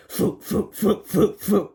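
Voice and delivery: puffed floop sounds; machine noises. A narrator's reading. Narrator sounding out the egg machine, puffed floops. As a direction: mimic the egg launcher. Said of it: "went the machine".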